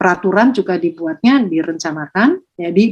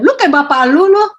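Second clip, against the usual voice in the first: louder, higher scornful jab; rude taunt; 8 semitones higher, 5 dB louder than usual. Retold or imitated. Parent comparing the child to his father, in a louder, higher jeering tone. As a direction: toss off a coarse parental insult. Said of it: "Kasar atau apa gitu".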